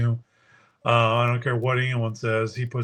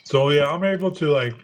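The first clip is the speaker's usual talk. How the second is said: normal talking voice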